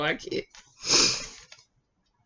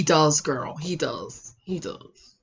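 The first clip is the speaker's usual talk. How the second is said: quiet; 8 semitones lower, 12 dB quieter, much slower than usual. Real, not acted. Quiet aside agreeing with the show.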